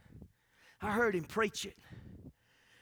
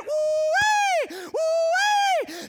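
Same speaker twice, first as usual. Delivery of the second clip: high, loud yodeling holler; long falling shepherd's holler; shepherd's call; 12 dB louder, much slower than usual. Retold or imitated. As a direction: call out across the hills like a shepherd. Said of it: "he went on the top of the hill and he went".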